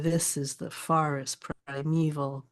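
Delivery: slow "THIS is the FORest priMEval"; "this is the forest primeval" with a measured strong-weak-weak swing; dactylic meter. Shown as a demonstration. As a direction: beat out "this is the forest primeval" in threes, first syllable strong. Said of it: "So the dactyl would be".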